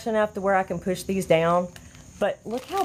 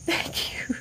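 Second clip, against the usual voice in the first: soft, voiceless thanks; overwhelmed whisper; 6 dB quieter than usual. Real, not acted. Host thanking viewers for gifts, in a choked whisper.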